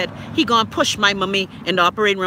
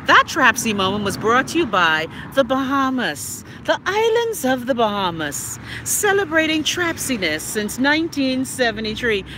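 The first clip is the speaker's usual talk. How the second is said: playful announcer-style tag line; mock sponsor read; 4 semitones higher than usual. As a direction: announce it like a tourism advert.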